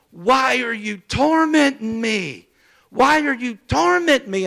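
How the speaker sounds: loud, high, drawn-out tormented cry; imitated holler. Possessed brother crying out through the hospital wall, hollering. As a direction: holler it out high, stretching the words. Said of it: "to holler".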